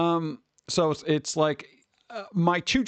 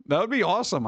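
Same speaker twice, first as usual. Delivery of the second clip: ordinary talk, nothing marked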